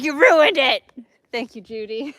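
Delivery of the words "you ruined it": very loud, high accusing shout; mad pet voice. Retold or imitated. Pet blaming the one who spoiled it, mad shout. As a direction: yell it like a furious, wronged pet. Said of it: "he was mad".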